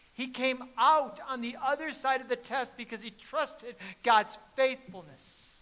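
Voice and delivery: loud, high, fervent preaching; preaching at full force. Acted. Preacher proclaims the angel's command to the congregation, shouting fervently. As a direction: proclaim it to the whole church.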